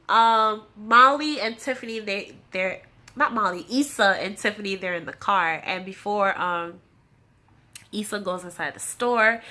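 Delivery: usual speaking voice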